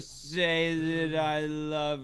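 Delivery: slow slurred drunk imitation; drunken slur. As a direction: drag the words out like a drunk. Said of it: "he sounded like a drunk guy".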